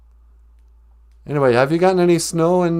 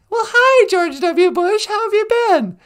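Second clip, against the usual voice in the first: hammed-up starstruck hello; mock delighted greeting; 13 semitones higher, 3 dB louder than usual. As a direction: greet him much higher, gushing. Host greets famous caller.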